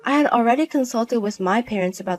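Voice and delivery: story narration. A narrator's reading. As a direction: tell the ending as narrator.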